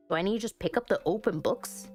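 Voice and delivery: ordinary talk, nothing marked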